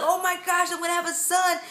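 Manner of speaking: high, gushing imitation of an overjoyed dad; ecstatic husband. Wife imitating husband learning he'll have a son, ecstatic. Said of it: "he was ecstatic".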